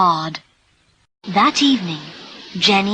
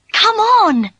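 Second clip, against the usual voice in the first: high, loud hurry-up call; excited shout, pitched way up; eager urging; 13 semitones higher, 6 dB louder than usual. Acted. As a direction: hurry your father along excitedly. Daughter hurries her father along.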